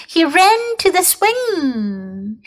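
ordinary delivery

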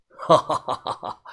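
middle-aged fixer's pleased laugh; hearty acted laugh. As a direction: run of staccato ha-ha laughter. (中年男人爽朗地哈哈大笑)

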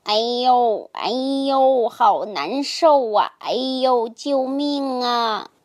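defeated snake wailing for help; snake crying out. A narrator's reading. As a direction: howl in pain, tied in a knot. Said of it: "忽然听见蛇大叫起来".

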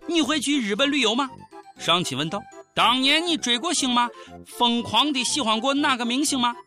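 ordinary talk, nothing marked

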